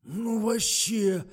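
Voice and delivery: high, loud, drawn-out protest; startled indignation. A narrator's reading. Driver jumps at investigator's accusation, startled indignation. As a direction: stretch it out in loud disbelief. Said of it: "подпрыгнул Тухтин".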